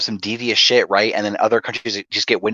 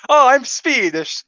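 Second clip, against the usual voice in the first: a hammed-up Swedish accent, much higher and slow; mock Swedish accent; 13 semitones higher, slower than usual. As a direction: play a random Swedish guy with a silly Swedish accent. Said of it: "if I meet some random Swedish guys".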